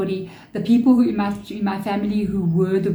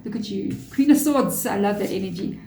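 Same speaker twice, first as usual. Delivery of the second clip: usual speaking voice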